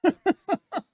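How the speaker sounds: high, loud burst of laughter. Real, not acted. Co-host reacts to teasing about tasting it all, laughing. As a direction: crack up out loud.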